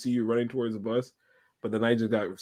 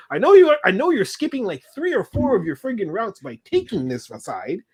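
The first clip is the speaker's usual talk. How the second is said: high-pitched, louder protest; indignant complaint; 8 semitones higher, 7 dB louder than usual. Retold or imitated. Passenger complaining to a driver about the detour, indignant.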